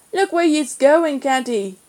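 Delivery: put-on ugly-tempered Cornish boy; Cornish snarl. A narrator's reading. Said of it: "he snarled, the Cornish accent made ugly".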